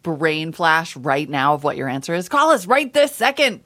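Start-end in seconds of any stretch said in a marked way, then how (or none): 2.3-3.6 loud, high hard-sell plug; mock urgency. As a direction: shout it like an urgent phone-in pitch. Hammed-up urgent plug for phone line.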